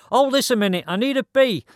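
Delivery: high, loud call; shouting. Acted. Rider to the lad pulling up alongside, shouted. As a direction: shout it over the engine. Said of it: "I shouted as he pulled up alongside me".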